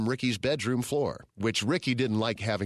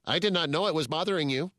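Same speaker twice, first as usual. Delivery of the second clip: higher, stiff metallic butler voice; robot voice; 6 semitones higher than usual. A narrator's reading. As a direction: speak like a polite machine. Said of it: "said the butler with its metallic voice".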